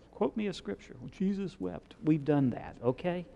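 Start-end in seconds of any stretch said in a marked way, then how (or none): 0.1-1.0 lightly voiced, higher, softer teasing prompt; a playful hint of a Sunday-school quiz; mock quiz prompt. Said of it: "We can all quote that". 1.0-2.0 mock rote, higher and softer "Well, Jesus wept"; rote recitation. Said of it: "We can all quote that".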